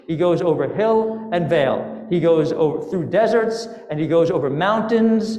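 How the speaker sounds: plain reading voice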